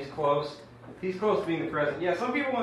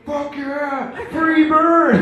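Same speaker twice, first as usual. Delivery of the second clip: high, loud hammed-up whoop; crowd cheer; 13 semitones higher, 12 dB louder, much slower than usual. Trump followers cheering his rant, rowdy mock yell. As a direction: yell like a rowdy rally fan.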